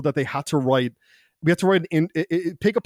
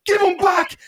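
high-pitched retold shout; hammed-up outraged cry; mock yell; 3 dB louder, slower than usual. Teller acts yelling at the woman.

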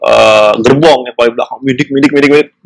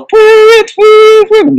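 train whistle imitation; 4 dB louder, much slower than usual.

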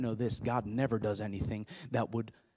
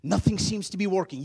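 usual speaking voice

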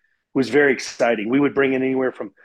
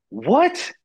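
high, rising incredulous yelp; stunned disbelief; 11 semitones higher than usual. Retold or imitated. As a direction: act out total disbelief on one word. Stunned reply to God's command.

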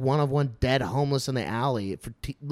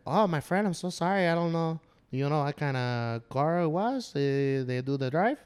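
imitated Mexican neighbor, apologetic and unsure; hesitant Mexican-accented old man; 2 semitones higher than usual. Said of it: "like, an old Mexican man".

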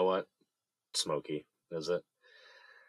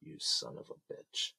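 bitter hushed whisper; whispered curse; 7 dB quieter than usual. Acted. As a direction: spit it out under your breath. Betrayed husband swears under breath.

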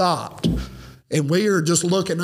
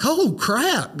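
pitched-up startled groan; dismayed oh crap; 7 semitones higher than usual. Retold or imitated. Telling a friend about a UFO, startled groan. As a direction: caught off guard, reliving the moment. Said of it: "And I'm like".

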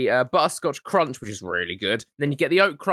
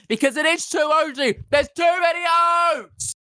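furious rant; 9 semitones higher than usual. Real, not acted. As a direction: rant in mock fury. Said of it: "I'm infuriated now".

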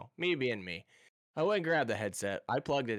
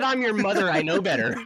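higher and louder mother's voice; mom's retort; 8 semitones higher, 7 dB louder than usual. Retold or imitated. Mom scolding her grown son, higher and louder. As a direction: snap back like a knowing mom. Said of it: "She said".

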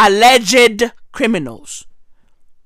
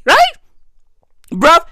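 ordinary talk

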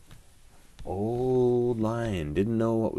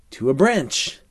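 ordinary delivery

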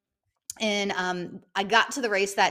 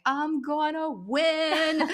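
smug triumph; 8 semitones higher, much slower than usual. Retold or imitated. Triumphant thought passing rival.

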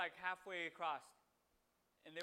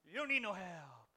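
a mocking, louder voice turning away someone drowning; dismissive brush-off; 9 dB louder than usual. Preacher mimicking unhelpful Christians to congregation, mocking dismissive voice. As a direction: sneer it off like helping is beneath you. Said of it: "but we're like".

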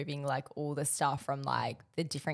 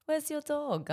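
imitating the postman in a high German accent; 11 semitones higher, slower than usual. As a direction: ask it like a German postman, pitched way up. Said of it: "he has a German accent".

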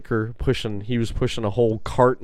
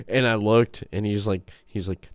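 usual speaking voice

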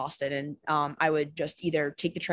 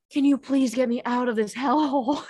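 a lightly voiced, higher child begging; inner child pleading; 6 semitones higher than usual. Her inner child begging to get out, lightly pleading. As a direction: playfully voice a trapped inner child. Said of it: "my inner child was like".

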